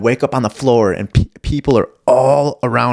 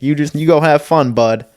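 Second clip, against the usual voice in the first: higher, breezy talk to a dog; covering as the dog walks away, higher; fake owner; 6 semitones higher, 4 dB louder than usual. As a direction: cover it with a casual send-off.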